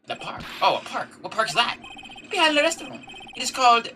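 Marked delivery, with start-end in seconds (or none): 2.3-3.9 lightly voiced Frenchman, higher than usual; a mild high, fluttery waiter voice; French owner voice. Owner directs the lawyers to the park, high and gracious.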